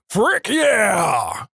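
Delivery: high, drawn-out holler back; yelling guy. Acted. Catchphrase guy trades yells with girl, yelling. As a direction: holler it back, stretching the yeah. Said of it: "back and forth yelling".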